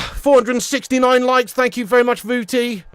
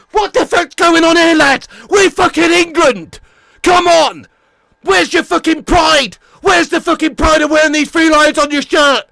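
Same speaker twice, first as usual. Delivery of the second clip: shouting, swearing at the manager, fast and loud; furious rant; 7 semitones higher, 5 dB louder than usual. Real, not acted. Football fan ranting to viewers about manager, furious shout. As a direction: rage at the team's failings, full volume. Angry rant after England match.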